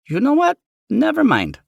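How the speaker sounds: high, loud motherly voice. Acted. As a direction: mom waving it off.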